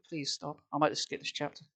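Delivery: ordinary delivery, nothing marked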